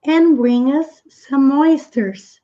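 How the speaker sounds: slow model reading. Shown as a demonstration. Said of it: "teacher models the line slowly".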